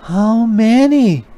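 mama asking how many Band-Aids; mama spider. A narrator's reading. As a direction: ask gently like a mom. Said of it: "asked her mama".

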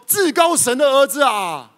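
high, loud pleading cry; possessed man yelling high and loud; demon's shout. Acted. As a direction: cry out to Jesus, begging not to suffer. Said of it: "他大声喊着说".

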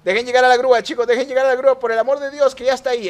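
high, loud, insistent plea; urgent begging shout. Real, not acted. Player begging the others to let the tow truck arrive, urgent shout. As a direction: beg them at the top of your voice.

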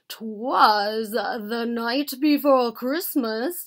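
slow, loud formal recitation; pronouncing. A narrator's reading. Bear reciting his long poem to Mouse, pronouncing it. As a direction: declaim the verse grandly, slowly. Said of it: "Bear pronounced".

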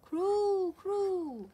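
loud high cooing; dove coo. Acted. Dove second time.

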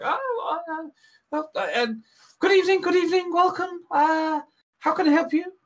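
high, flustered barmaid, stammering her welcome; a nervous tavern maid, voice up high and eager. Acted.

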